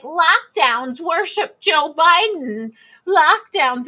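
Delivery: mock governor. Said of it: "he's like".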